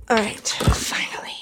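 relieved breathy whisper. Real, not acted. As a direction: whisper it to yourself. After long struggle with box.

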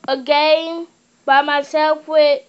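yelling up the stairs; calling out. Real, not acted. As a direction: call out loudly to grandpa. Yelling to grandpa downstairs.

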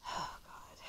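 awed whisper; breathy oh god. Real, not acted. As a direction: exhale it in awe. Overwhelmed by coincidence.

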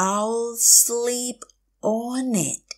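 long "I'll sleep", pause, then "on it"; slow teacher model of "I'll sleep on it"; slow, drawn out. Shown as a demonstration. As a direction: stretch each word of "I'll sleep on it". Teacher repeats phrase slowly.

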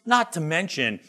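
ordinary talk, nothing marked

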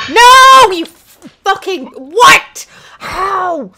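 high, loud "No!" then a slow dragged-out "fucking, what?"; outraged cry. Real, not acted. Gamer cries out as he slips down. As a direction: disbelief breaking into rage.

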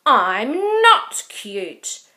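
slow, loud indignant shout. Acted. Baby Owl shouting at Rabbit, loud and slow. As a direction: shout it, stamping your foot. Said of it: "shouted Baby Owl".

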